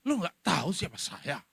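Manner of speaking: lightly voiced offended snub; a touch of haughty you-don't-know-who-I-am; hinted arrogant brush-off; mild mock indignation. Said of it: "Begitu ada yang kritik".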